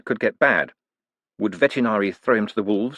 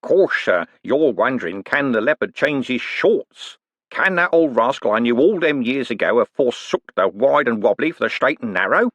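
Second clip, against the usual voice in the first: higher, wheedling rogue's voice with a knowing lilt; sly old conman; 4 semitones higher, 3 dB louder than usual. Acted. Old con man teasing Moist about his past, sly and wheedling. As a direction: needle him like a grinning old crook. Cribbins voice again.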